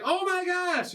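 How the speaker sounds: high-pitched mock gasp of dismay; shocked cupid. Cupid girl finds the guy she shot, mock shocked.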